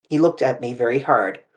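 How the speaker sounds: ordinary delivery, nothing marked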